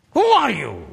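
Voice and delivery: sharp, high and loud demand; preacher rebuking the doubter; indignant challenge. Real, not acted. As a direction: confront the listener with it.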